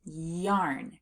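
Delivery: yarn slowly, holding the y at the start; stretched first sound. Shown as a demonstration. Teacher asking learners to say yarn, slowly stretched. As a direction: draw out the opening y of yarn. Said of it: "Can you say yarn?".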